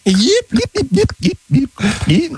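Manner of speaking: high robotic beeping; droid beeps. Pretend. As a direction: beep like a droid. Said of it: "alien voice on request".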